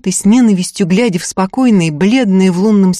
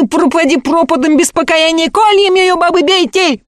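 frenzied mob woman; 8 semitones higher than usual. Acted. Fyokla urging the women to beat Ustinya, high frenzied cry. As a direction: whip the crowd into a frenzy.

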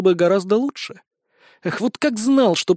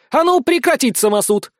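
high, raised commanding bark; barked order; 8 semitones higher, 4 dB louder than usual. A narrator's reading. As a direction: bark it to halt a mob. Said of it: "рявкнула блондинка". (резкий командный окрик)

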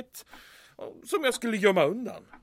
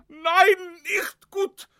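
distressed; 8 semitones higher, 6 dB louder, much slower than usual. Acted. Panicking officer fears Berlin.

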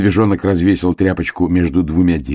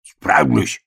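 short defiant snap; cross little bear retort; snapped retort; 7 semitones higher than usual. A narrator's reading. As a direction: bear cub barks back, higher. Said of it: "рявкнул медвежонок".

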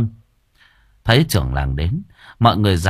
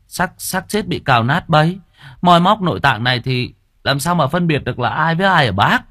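quick reply; 6 semitones higher than usual. Acted. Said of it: "nhanh miệng đáp".

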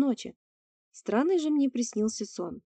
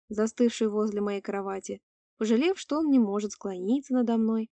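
plain reading voice

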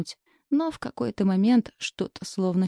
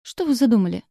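alarmed outburst; 4 semitones higher, 5 dB louder, faster than usual. Acted. Heroine panics at his plan.

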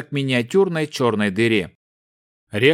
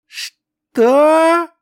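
otherworldly shriek, much higher and louder; eldritch horror; 7 dB louder than usual. Acted. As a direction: shriek it like a ghost from beyond. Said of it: "потусторонним голосом произнесла тень".

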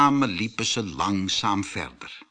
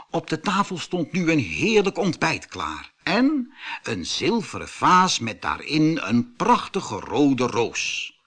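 ordinary delivery

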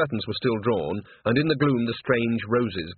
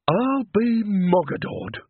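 much higher, very slow astonished exclamation; stunned curse again; 10 semitones higher, much slower than usual. A narrator's reading. Nanny still staring at made-up Granny, high slow curse. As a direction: squeak the curse out, slower and higher in disbelief. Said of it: "said Nanny again".

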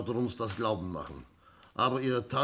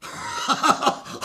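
bursts into a high, loud laugh; a sudden hearty burst of laughter; 7 dB louder than usual. Acted. Inspektor lacht über den Witz. (lautes, herzhaftes Auflachen)

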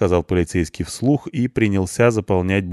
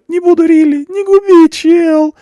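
very high, much louder desperate cry; desperate begging; 11 dB louder than usual. A narrator's reading. Punk begs not to be ruined, loud desperate cry. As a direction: plead at the top of his voice. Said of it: "взмолился тот".

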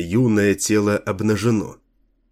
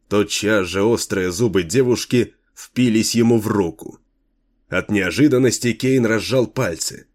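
ordinary delivery, nothing marked

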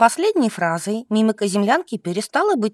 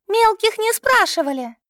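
very high-pitched, contained yell; yelling back; 12 semitones higher than usual. A narrator's reading. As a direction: snap back high at a junior. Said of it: "заорала в ответ младшая Макарова". (крик в ответ, очень высоко)

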